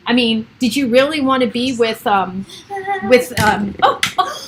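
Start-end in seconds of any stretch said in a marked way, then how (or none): 3.8-4.4 sudden high squeal of recognition; excited recognition. Real, not acted. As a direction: pop up high, thrilled to spot him. Spots the actor on screen.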